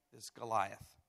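ordinary delivery

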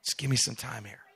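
Jesus quietly reassuring Peter; smiling reassurance. Retold or imitated. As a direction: smile through it, calm and kind. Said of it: "Jesus just kind of smiles and says".

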